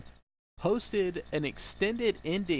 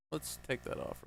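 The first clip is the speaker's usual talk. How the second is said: muttered; 10 dB quieter than usual. Real, not acted. Muttering while removing headset.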